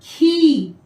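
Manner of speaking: खी with a stretched long ii; drawn-out long ii. Shown as a demonstration. Teacher modelling खी for children. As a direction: pull the vowel of खी long. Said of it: "क्या होगा उच्चारण".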